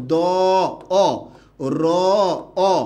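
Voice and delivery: দ, অ, র, অ said one at a time with gaps; sounds broken apart. Shown as a demonstration. Teacher splitting a word for the class, sounds broken apart. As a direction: draw out each sound in দ, অ, র, অ separately. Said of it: "এখানে কি উচ্চারণ করেছি".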